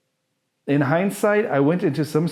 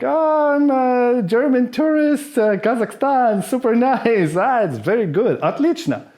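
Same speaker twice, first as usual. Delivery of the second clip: fake Borat tourist; 9 semitones higher than usual. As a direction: play the clueless foreign tourist, all cheer. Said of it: "some sort of Borat".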